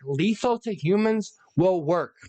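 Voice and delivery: ordinary delivery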